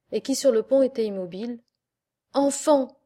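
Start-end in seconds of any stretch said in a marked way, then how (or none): 2.3-3.0 a loud, higher call to the crew; commanding shout. Acted. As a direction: call out to stop the fire. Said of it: "crie-t-il enfin".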